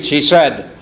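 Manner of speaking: normal talking voice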